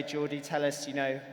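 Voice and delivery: ordinary talk, nothing marked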